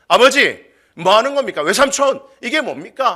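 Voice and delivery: high, loud outburst of acted rage; furious confrontation. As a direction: demand an explanation angrily. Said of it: "미치도록 라반에게 화를 내면서". (화가 나서 따지는 고함)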